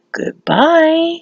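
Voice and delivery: high, drawn-out goodbye; acted-out farewell. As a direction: lift the word high and stretch it. Friends' line in retold scene.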